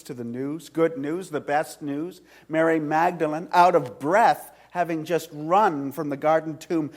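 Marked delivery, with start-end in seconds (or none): none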